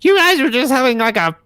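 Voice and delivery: high prim scolding voice; Miss Hardbrook impression. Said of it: "Miss Hardbrook is just like".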